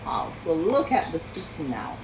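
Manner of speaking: plain reading voice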